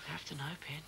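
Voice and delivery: soft unvoiced plea; whispering. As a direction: breathe it out, barely voiced. Quiet plea to frightened wife.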